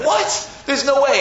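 high, loud incredulous reaction; hammed-up shock; mock disbelief. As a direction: act astonished, voice shooting up. Dad's feigned disbelief, acted.

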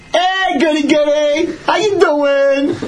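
high, drawn-out holler across water; mocking call-out. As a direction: taunt from afar, stretching the words. Brother calls from passing sailboat.

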